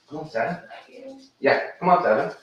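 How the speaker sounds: high loud call to the dog. Real, not acted. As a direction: yell for the dog to come. Calling his dog Seven.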